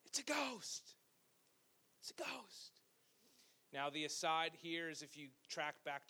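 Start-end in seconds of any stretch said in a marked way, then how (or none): 0.1-0.9 higher, frightened cry of terror. A narrator's reading. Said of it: "they cried out". 2.0-2.8 softer, whispered terror. A narrator's reading. Whispered echo of the cry.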